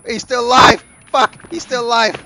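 alarmed yell; panicked shout. Real, not acted. Enemy survives, panicked yelling.

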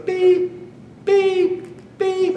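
high, beeping like a backup camera; imitated warning beep. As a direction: mimic the parking sensor beep. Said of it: "the warning sound starts going off".

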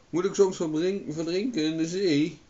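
high, loud protesting question; indignant shout. A narrator's reading. Owen fears drowning if he lets go, indignant shout.

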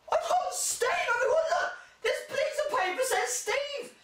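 very high, put-on excited voice with laughter; mock gleeful yell. Mocking someone who shouts their Secret Santa name, very high. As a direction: wave the paper about like a giddy show-off.

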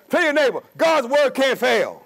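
normal talking voice

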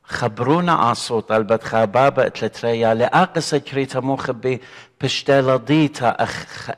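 ordinary talk, nothing marked

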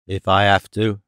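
low-pitched reluctant mutter; grudging mutter. A narrator's reading. Norman agreeing to answer questions, grudging low mutter. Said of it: "Norman sighed and muttered".

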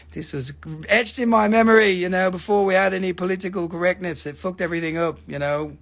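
ordinary talk

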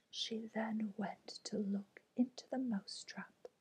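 plain reading voice

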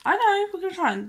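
high and quick, excited for the dog; excited dog-talk. Real, not acted. Owner promising her eager dog a treat, excited and high. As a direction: hype the dog up, high and fast.

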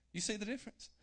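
usual speaking voice

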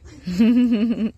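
loud chuckle; short burst of giggles; real laugh. Real, not acted. Amused by the cat.

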